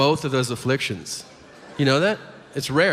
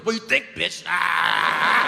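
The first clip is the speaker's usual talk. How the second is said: mock tic outburst. Boy with Tourette's answering the substitute, mock outburst. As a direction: fire back rudely, then cry out. Kid snaps back at substitute.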